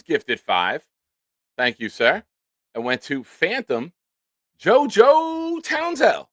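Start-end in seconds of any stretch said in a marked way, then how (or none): 4.6-6.3 drawn-out, high, louder ring-announcer call; announcer name call. Acted. Streamer announcing a gifted viewer's name, slow arena call. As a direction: boom the name out like an arena intro. Calling out gift recipient name.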